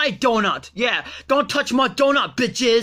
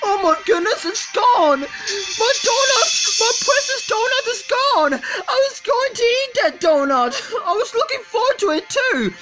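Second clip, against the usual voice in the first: very high, whining mock despair; hammed-up high-pitched lament; anguished wailing; 11 semitones higher than usual. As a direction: wail as if tragedy has struck. Mourns the missing donut.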